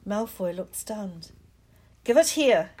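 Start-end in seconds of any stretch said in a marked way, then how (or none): 2.0-2.7 loud, higher challenge; call. Acted. Harry calls to Malfoy in midair, loud and higher. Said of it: "Harry called".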